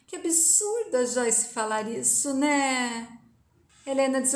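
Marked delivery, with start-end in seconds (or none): none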